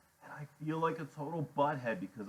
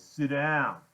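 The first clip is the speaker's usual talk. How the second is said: louder, higher command; sharp order; 5 semitones higher, 9 dB louder than usual. A narrator's reading. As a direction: order him into the chair. Iggy orders kid to sit.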